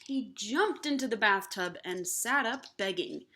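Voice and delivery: plain reading voice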